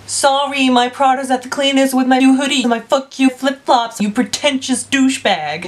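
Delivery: badly faked American movie-character voice; bad American accent. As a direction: quote it in a shaky put-on American accent. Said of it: "I cannot do an American accent".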